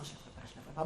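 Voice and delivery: whispered father avoiding his daughter; muttered as he walks past her; whispered quote. Retold or imitated. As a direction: breathe it out, no voice at all. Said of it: "il passait devant vous en disant".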